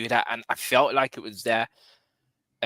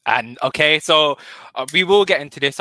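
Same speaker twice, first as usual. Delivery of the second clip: loud taking charge; 3 semitones higher, 10 dB louder than usual. Real, not acted. Host reining in debate.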